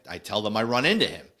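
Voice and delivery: normal talking voice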